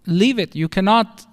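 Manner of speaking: normal talking voice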